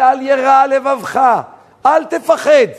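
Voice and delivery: slow, high, drawn-out words of comfort; retold emphatic reassurance. Elijah reassures the weeping fisherman.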